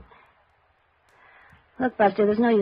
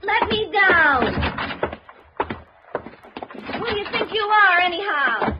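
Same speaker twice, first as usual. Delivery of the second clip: indignant outburst; 12 semitones higher than usual. Acted. Clerk stuck on desk.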